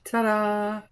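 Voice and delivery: bright, high showy ta-da; ta-da reveal. Real, not acted. Vlogger unveils her fresh coat with a high ta-da. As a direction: present the nail like a magic trick.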